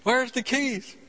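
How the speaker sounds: high, panicked mock yell for mom; retold frantic yell. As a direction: yell for the keys, voice jumping high. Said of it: "runs in the house screaming".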